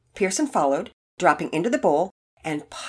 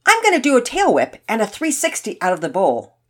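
loud, higher-pitched boast; cocksure announcement; 5 semitones higher, 6 dB louder than usual. A narrator's reading. Todd announcing his tricks to the boys, cocksure. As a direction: announce it, sure of yourself. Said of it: "cocksure of himself".

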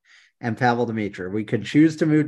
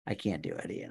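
ordinary delivery

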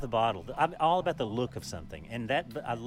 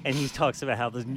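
normal talking voice

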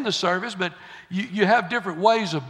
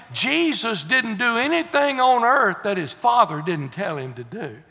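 ordinary delivery

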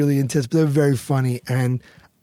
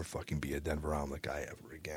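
whisper; 11 semitones lower, 19 dB quieter, faster than usual. Real, not acted. Swears off Denver omelets.

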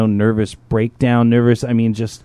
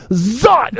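high playful zot; wife's giddy zap noise; zapping noise; 10 semitones higher than usual. Retold or imitated. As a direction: mimic a little zap sound, high. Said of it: "she just goes".

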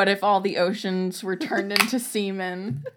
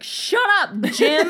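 retold yell; 9 semitones higher, 7 dB louder, slower than usual. Acted quarrel, retold.

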